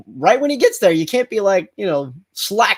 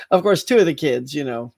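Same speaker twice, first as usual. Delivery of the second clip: ordinary talk, nothing marked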